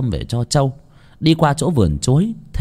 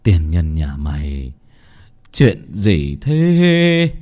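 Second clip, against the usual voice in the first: put-on cranky sick old master; 5 semitones lower, 3 dB louder, much slower than usual. A narrator's reading. Bedridden master asks what the commotion is, crankily. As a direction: start low and slow, then rise loud and stretch the end.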